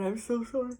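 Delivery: apology through a yawn; mid-yawn apology. Real, not acted. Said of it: "I'm gonna yawn again".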